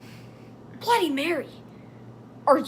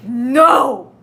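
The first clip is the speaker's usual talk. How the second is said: loud mutter; 7 dB louder than usual. Acted. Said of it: "he muttered loudly".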